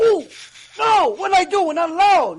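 loud, high-pitched alarm; hammed-up panicked husband; mock panic. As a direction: blurt it out in acted horror. Husband panics on seeing his wife.

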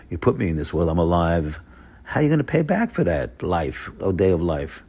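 ordinary talk

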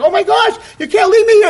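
high, loud, pleading outburst; alarmed cry. Acted. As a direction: cry out to someone who is leaving you. Princess cries out as friend leaves.

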